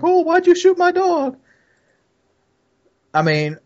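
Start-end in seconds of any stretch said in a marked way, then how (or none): 0.0-1.4 very high, wailing imitation of a dog owner; exaggerated high-pitched mock lament; mock upset owner. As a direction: whine it in a silly high voice. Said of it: "and the guy's like".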